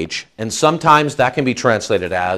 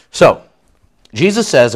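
usual speaking voice

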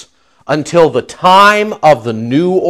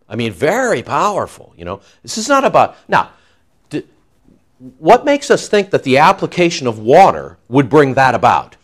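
normal talking voice

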